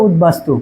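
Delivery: easy, relaxed উদ্বাস্তু as utbastu; natural pronunciation. Shown as a demonstration. Teacher showing students the natural way to say উদ্বাস্তু. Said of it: "এই যে".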